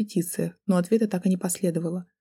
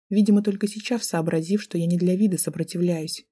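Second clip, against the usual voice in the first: usual speaking voice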